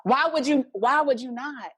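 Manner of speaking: loud, high incredulous retort; acted-out outraged disbelief; indignant. Indignant retort about no numbing medicine.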